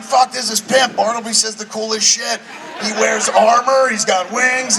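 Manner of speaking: high-pitched imitation of an excited friend; gushing excitement. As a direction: gush like a friend sold on the role. Retelling friend gushing about a role.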